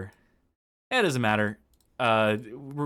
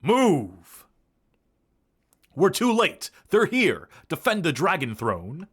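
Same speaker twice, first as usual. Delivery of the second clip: shouting guard; 8 semitones higher, 6 dB louder, slower than usual. Acted. Guard voiced in game dialogue.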